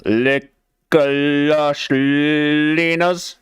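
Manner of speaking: drawn-out slow delivery. Shown as a demonstration. Slogan said slowly to the viewers. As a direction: stretch every word of lush leaners out. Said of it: "That's the slowest I've ever said it".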